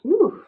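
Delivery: a high, squeaky ooh of discomfort; uneasy ooh. Real, not acted. To viewers, bracing to tell an awkward story, uneasy high ooh. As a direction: wince out loud before telling the hard part. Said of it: "it's a little uncomfortable".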